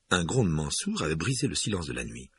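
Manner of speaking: ordinary delivery, nothing marked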